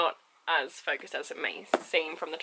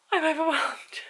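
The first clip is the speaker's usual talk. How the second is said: overwhelmed; 9 semitones higher, 4 dB louder, slower than usual. Real, not acted. Gushing over unboxing items.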